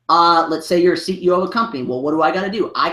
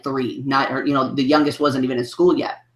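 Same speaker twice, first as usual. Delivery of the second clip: ordinary talk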